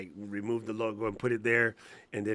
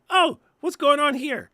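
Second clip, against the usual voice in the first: high, loud mock British reaction; hammed-up British gasping at a video; British reactor impression; 7 dB louder than usual. Mocking the British reaction guy, excited British voice. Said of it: "There's a British dude".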